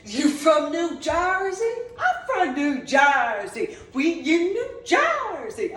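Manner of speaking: laughing parody of hometown bragging; mock tough guy. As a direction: ham up a proud Jersey kid. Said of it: "walking around going".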